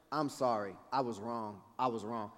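low, soft admission of fault; meek apology. Retold or imitated. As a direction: back down quietly and humbly. Retold meek apology at counter.

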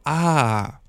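drawn-out "ah" as it clicks; retold moment of understanding; dawning realisation. Storyteller finally understanding the girl's answer, slowly realising. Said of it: "dann war ich so".

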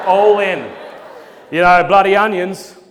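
loud, high, laughing punchline; all-in joke delivery. Shown as a demonstration. Comic committing to a lame onion joke, belted. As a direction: go all in and belt it. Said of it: "I'm gonna go all in on this bad joke".

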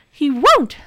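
high, loud, firm; determined. Acted. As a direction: say it with resolve. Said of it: "said Nora determinedly".

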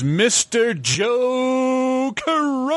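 high, slow ring announcer build. Acted. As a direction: drag out the name like a ring announcer. Hyping the guest's introduction.

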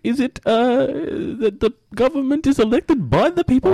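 high, hesitant comic caller; [name]. Acted. Disguised caller answering the host's quiz, high and halting. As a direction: feel out the answer in a squeaky voice.

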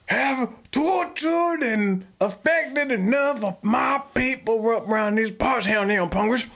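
sentence him like a frontier sheriff; cowboy king. Pretend. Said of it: "I'm a cowboy king".

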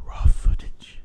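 loud stage whisper, accented; dark whisper. Shown as a demonstration. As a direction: hiss it out darkly. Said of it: "but you said it so dark".

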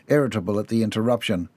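ordinary delivery, nothing marked

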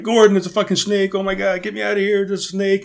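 high-pitched mock yell for help; retold panicked yell. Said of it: "I'm screaming, I'm yelling".

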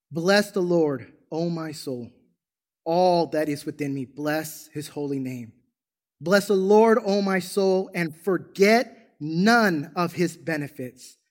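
slow, prayerful psalm recital; unhurried verse with drawn-out key words; slowed-down scripture reading. Real, not acted. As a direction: read it slowly, almost praying it. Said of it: "really take the time when I read this scripture to slow down".

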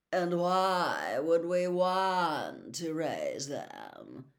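slow, cold disdain. A narrator's reading. Malfoy sneers at Hagrid's project, cold and slow. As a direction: drag it out with contempt. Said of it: "said a cold voice".

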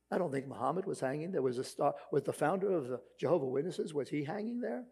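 normal talking voice

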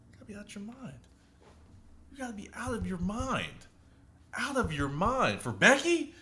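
high-pitched voice of disbelief; mock incredulity. Retold incredulous retort to cheating talk.

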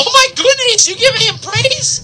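high-pitched mock astonishment; sarcastic squeal of disbelief. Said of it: "I'm being, like, sarcastic".

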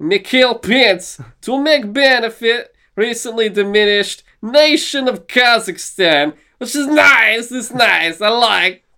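high, slow, heavily put-on foreign accent; hammed-up foreign reporter, pitched high and drawn out; Borat. Host finishing the movie title for his co-host, in a high, slow Borat voice. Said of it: "gonna try to do it in a Borat voice".

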